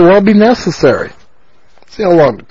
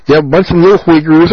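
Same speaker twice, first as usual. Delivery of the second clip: ordinary talk, nothing marked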